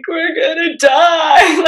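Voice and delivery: high, slow, loud wail of panic; panicked cry. Retold or imitated. Wife recalls panicking at the diagnosis, loud high wail. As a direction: cry it out as if all is lost. Retelling her panic that night.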